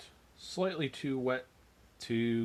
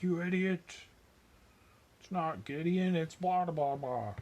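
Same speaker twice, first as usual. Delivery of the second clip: higher-pitched put-on fan outrage; mock outraged yell; 8 semitones higher than usual. Miniature painter voicing an irate Tolkien fan at the camera, mock yelling. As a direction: imitate an indignant nerd yelling. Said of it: "yelling at the camera like".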